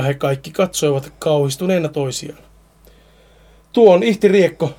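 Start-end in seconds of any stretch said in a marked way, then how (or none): 3.7-4.7 higher, louder alarmed cry; mild old woman's alarmed shout; frightened shout. Acted. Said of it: "Hilda huusi".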